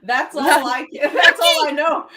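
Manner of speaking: high, loud, laughing Spanish phrase. Real, not acted. Laughing at the only Spanish she knows. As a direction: giggle through the beer order.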